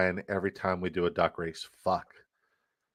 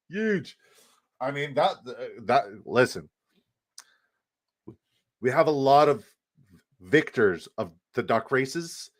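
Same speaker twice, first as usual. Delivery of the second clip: Donald Trump; 3 semitones higher than usual. Acted. Streamer bragging about the biggest winner, cringey Trump impression. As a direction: do a cringey, hammed-up politician impersonation. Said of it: "my Donald Trump impression 'cause it's really fucking cringe".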